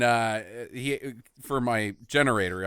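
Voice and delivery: usual speaking voice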